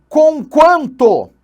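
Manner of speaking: "Conquanto" stretched slow and high, each syllable drawn out; drawn-out keyword. Shown as a demonstration. Teacher stretching the word "Conquanto" for memorizing, slow and high. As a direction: draw out "Conquanto" so students memorize it.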